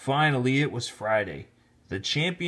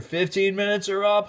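higher, hollering call; hollered; 8 semitones higher, 4 dB louder than usual. Acted. Referee hollering the break is over. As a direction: holler it across the field. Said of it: "hollered Jake".

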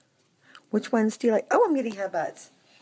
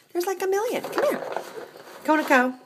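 much higher, sweet call to come; pet-talk coaxing; 9 semitones higher than usual. Acted. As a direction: coo the cat over. High pet talk to the cat.

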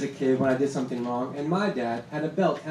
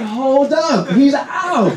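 much higher, loud indignant call; protesting call; 9 semitones higher, 9 dB louder, much slower than usual. Retold or imitated. Fan protesting the batter who stays at the plate, high loud yell. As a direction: yell it like the ump missed it. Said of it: "I'm like".

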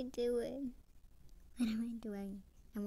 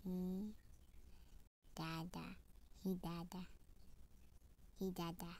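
put-on baby voice; 7 dB quieter than usual. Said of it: "I'm gonna say he dada".

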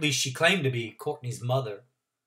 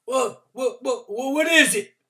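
put-on flustered old man; 11 semitones higher, 7 dB louder than usual. Mr Randolph flustered.

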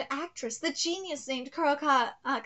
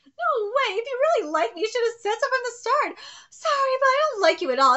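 higher, louder and faster, with a crying laugh; reread, hammed-up rejection; 8 semitones higher, 5 dB louder, faster than usual. Shown as a demonstration. Rereading the cold rejection, fully hammed up. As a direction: ham up the rejection even more. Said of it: "let me read this again".

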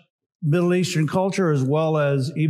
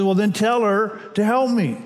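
a touch of an impatient Martha, pitched higher; Martha's demand; 5 semitones higher than usual. Retold or imitated. Preacher voicing Martha's demand to Jesus, mildly impatient. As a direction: voice Martha pushing back, lightly higher. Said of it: "immediately she says".

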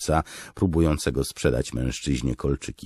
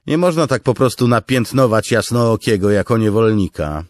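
loud, high sceptical lieutenant; shrewd remark; 10 semitones higher, 12 dB louder than usual. Acted. Noro probing Kaladin's past, loud sceptical remark. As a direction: point out the flaw in his story. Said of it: "zauważył porucznik Noro".

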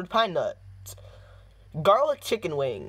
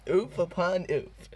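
ordinary talk

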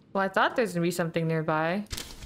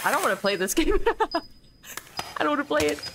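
scared whining; 6 semitones higher than usual. Real, not acted. Frightened player pleading.

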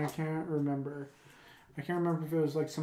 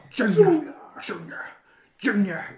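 raspy whispered call through a tight jaw; tight-jawed impression voice; 5 semitones higher, 6 dB louder than usual. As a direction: call out the name wide-eyed, half whispered. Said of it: "I have to have really wide eyes".

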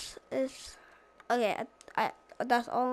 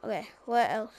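ordinary talk, nothing marked

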